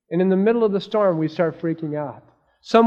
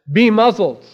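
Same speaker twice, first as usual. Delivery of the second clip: raised, commanding rebuke; forceful command; 5 semitones higher, 9 dB louder than usual. Retold or imitated. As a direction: order the storm silent, loud and higher. Jesus rebukes the storm.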